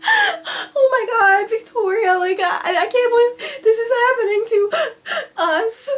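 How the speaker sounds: high, gasping fake sobs; loud mock weeping, broken by gasps; pretend crying. Prankster plays along with upset friend, fake crying. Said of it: "I'm making that kinda sound like crying".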